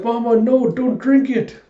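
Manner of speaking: put-on very high, urgent little-girl cry; panicked child. Small girl warns her mother off poison, high panic. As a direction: beg loudly in a child's voice.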